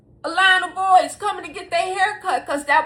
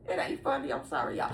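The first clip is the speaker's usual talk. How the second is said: normal talking voice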